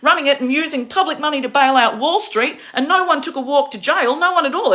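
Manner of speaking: loud, high, heated tirade; angry rant. Real, not acted. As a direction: rail against the bankers. Angry rant about Wall Street.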